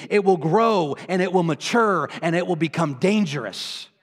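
forceful preaching. Real, not acted. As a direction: warn the congregation with mounting urgency.

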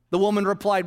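usual speaking voice

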